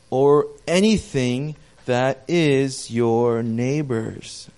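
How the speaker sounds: slow, weighty scripture read to the congregation. Acted. Preacher reading Exodus to the church, slow and solemn. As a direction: let each word of the verse land.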